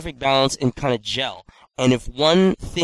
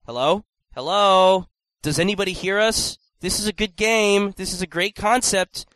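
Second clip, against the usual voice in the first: higher, louder hello into the void; calling out; 6 semitones higher, 3 dB louder than usual. Pretend. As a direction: call out to nobody listening. Calling out to be heard.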